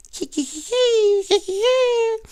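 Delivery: hammed-up frightened squeal. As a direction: squeal like a terrified little puppy. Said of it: "screamed the puppy in fright".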